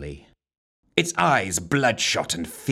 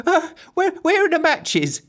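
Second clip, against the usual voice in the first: put-on squeaky girl voice; 5 dB louder than usual. A narrator's reading. Giggles voiced very high.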